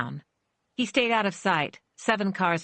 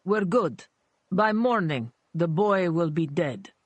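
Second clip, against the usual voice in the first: menacing thug voice. A narrator's reading. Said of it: "he told his boss in Russian".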